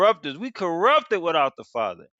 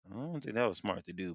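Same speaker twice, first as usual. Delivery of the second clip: usual speaking voice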